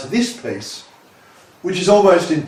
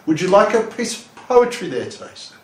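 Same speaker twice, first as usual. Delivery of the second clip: lightly acted pitch to a passerby, higher; a hint of a street poet's polite offer, voice lifted; 8 semitones higher than usual. Poet offering a passerby a poem on the street, lightly acted pitch. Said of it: "where I would say".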